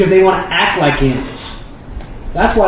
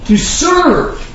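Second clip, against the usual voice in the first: ordinary delivery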